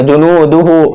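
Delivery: "जुनू दुहु" with नू and हु lengthened; measured ju-noo-du-hoo for "जुनू दुहु"; stretched long vowels. Shown as a demonstration. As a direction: keep दु brief, hold the final hoo in "जुनू दुहु". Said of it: "इसलिए इनको खींचकर पढ़ेंगे".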